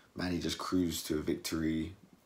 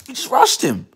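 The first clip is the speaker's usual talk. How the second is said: sudden high, loud burst; excited outburst; 14 dB louder than usual. Real, not acted. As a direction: blurt it out excitedly. Excited peak of fight story.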